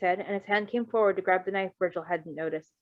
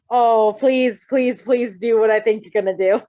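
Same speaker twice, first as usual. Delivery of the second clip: much louder, eager begging; excited pleading; 9 dB louder than usual. Real, not acted. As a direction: beg with giddy delight. Fan begs excitedly, laughing.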